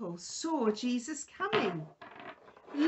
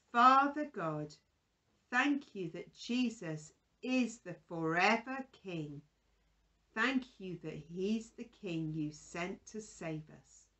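soft, quieter prayer voice; children's prayer; 6 semitones lower, 3 dB quieter than usual. Acted. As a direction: pray gently on behalf of the children.

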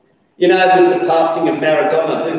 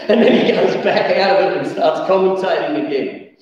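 usual speaking voice

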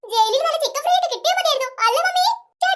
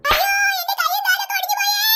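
overacted crying; 6 semitones higher than usual. Girl faking broken limbs to the seller, high mock wail. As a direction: howl in mock agony. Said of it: "എന്നെ acting ആ കൊച്ച്".

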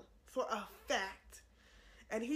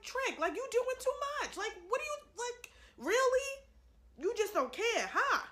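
high-pitched, exasperated mock telling-off; incredulous scolding; 10 semitones higher, 4 dB louder than usual. Imagining God fed up with her sins, incredulous scolding. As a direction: voice God's disbelief, pitched way up.

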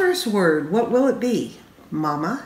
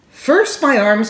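put-on excited toddler; 3 semitones higher, 7 dB louder than usual. A narrator's reading. Car seat poem opener.

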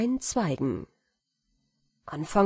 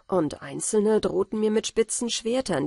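ordinary delivery

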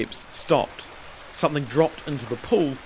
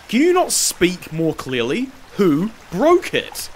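raised, deliberate, with BROKE drawn out; exasperated demand; 5 semitones higher, 4 dB louder than usual. A narrator's reading. Master repeats question to stammering slave, exasperated. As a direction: spell the question out impatiently.

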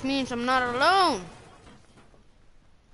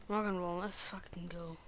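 swearing tirade; 6 semitones higher, slower than usual. Real, not acted. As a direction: rage at the bad luck. Dies in game, raging.

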